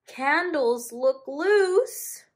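high, slow mildly worried voice; worried moose. Acted. Moose worrying about loose candles, mild worried voice. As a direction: fret gently over the candles. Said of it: "worried the moose".

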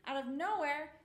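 usual speaking voice

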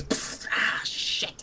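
unvoiced, hushed hiss of dismay; whispered curse. Game master voices a reaction to thrown acid, whispered curse. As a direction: puff out air, then whisper the curse.

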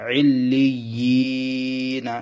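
"ইল্লিয়িনা" slowly, long level yi before na; elongated madd. Shown as a demonstration. Teacher demonstrates one-alif madd, "ইল্লিয়িনা" with long drawn yi. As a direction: stretch the yi in "ইল্লিয়িনা". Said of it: "এক আলিফ টানতে হবে".

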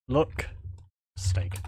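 ordinary talk, nothing marked

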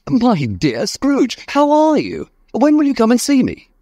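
high, gushing passer-by voice; friendly greeter. Acted. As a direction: greet an old friend effusively, high-pitched. Imagined friendly greeter in street.

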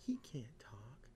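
slow whispered aside; whispering. Real, not acted. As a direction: breathe it like a secret. Aside about the character.

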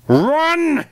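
high, loud call to run; high, loud cry; urgent shout. Acted. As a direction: scream at them to flee. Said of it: "Kit called again".

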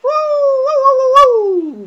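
high, loud burst of laughter. Real, not acted. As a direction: let out a big loud laugh. Big laugh at own play.

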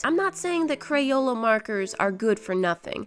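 ordinary delivery

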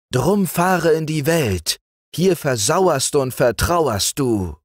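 high, slow singsong calling; magic books calling the boy away, high and luring. A narrator's reading. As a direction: beckon him out into the world. The books call to Franz.

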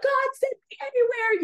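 high, loud tearful plea; mock bawling. Crying out to God at the altar, acted-out bawling. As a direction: imitate a bawling, fervent prayer. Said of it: "I'm bawling, crying".

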